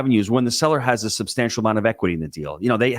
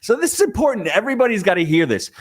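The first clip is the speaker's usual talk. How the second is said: urgent emphasis; 9 semitones higher than usual. Real, not acted. Host stressing a key point.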